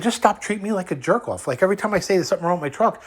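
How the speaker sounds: high, fast exasperated rant; heated complaint. Retold or imitated. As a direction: vent it, pitch climbing. Retold argument, raised pitch.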